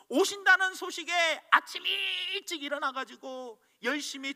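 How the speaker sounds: high and loud, bubbling over with joy; a grandfather who can't wait for tomorrow; excited old man. Retold or imitated. The cobbler tells everyone Jesus is coming, high and loud with joy.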